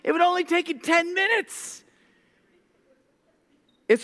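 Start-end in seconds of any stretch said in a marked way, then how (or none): 0.0-1.8 self-scolding shout. Retold or imitated. Driver mocks his own mistake with a high shout. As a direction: berate yourself for the forgotten gas.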